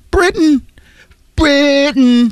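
very high, comic call; playful falsetto-like exclamation; high-pitched mock cry. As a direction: sing out the word far above normal. Playful mock cry after accent bit.

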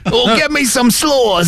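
slow, high mock British; drawn-out hammy English accent. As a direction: stretch it like a caricature Brit. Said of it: "old guy with a British accent".

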